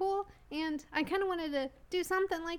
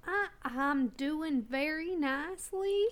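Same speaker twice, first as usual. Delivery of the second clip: slow Southern drawl; Southern accent; slower than usual. Acted. Costumed guest answering how they are, slow Southern accent. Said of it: "You like the accent?".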